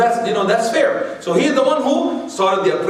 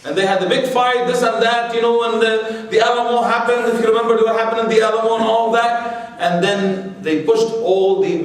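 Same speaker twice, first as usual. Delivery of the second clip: ordinary delivery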